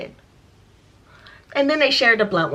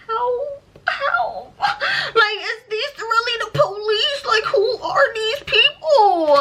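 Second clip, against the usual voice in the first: laughing through tears; 12 semitones higher, slower than usual. Real, not acted. Laugh-crying while retelling the robbery.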